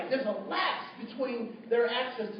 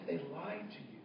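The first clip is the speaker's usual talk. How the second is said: hushed reveal; 5 semitones lower, 10 dB quieter than usual. Retold or imitated. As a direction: drop low and soft for the verdict. Solemn reveal to the student.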